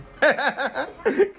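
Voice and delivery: amused high laugh at the prank; laughing as the guest tastes the salty soda; high burst of laughter. Real, not acted. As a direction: giggle breaking out.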